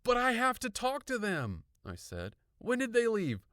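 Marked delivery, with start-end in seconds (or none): 0.0-1.6 high, loud protest; urgent insistence. A narrator's reading. Frantic girl insisting. 2.6-3.4 high, loud rapid-fire questions; frantic questioning. A narrator's reading. Frantic girl questioning her brother.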